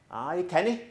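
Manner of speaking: a light attempt at a Geordie accent; mild imitation of a Geordie colleague. Colleague Brian greeting him in a mild Geordie accent. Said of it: "that's the best I can do in terms of Geordie accent".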